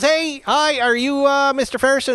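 a high, chirpy polite greeting; mimicking the washer's cheerful hello; high friendly voice. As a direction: play the window washer bright and eager. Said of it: "And he goes".

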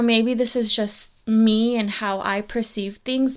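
ordinary talk

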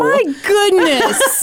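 high, slow, laughing shock; scandalized exclamation. Real, not acted. Co-host reacting to a rude joke, scandalized. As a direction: stretch it out, amused and aghast.

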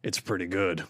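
a hint of Rambo's quiet growl before a kill; low gruff Rambo voice. Psychic passes on Rambo's verdict in a lightly gruff, low voice. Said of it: "in that low, gruff tone".

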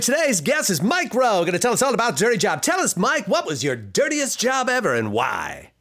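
high, loud, fast-talking DJ intro; hyped radio host. Retold or imitated. Co-host riffing as an American DJ, high and loud hype. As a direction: pump the guest up like a morning zoo host. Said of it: "That's what you hear in Chicago".

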